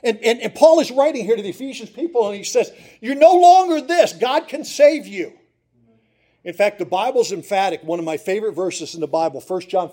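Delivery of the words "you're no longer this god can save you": proclaiming. Acted. Preacher voicing Paul's declaration.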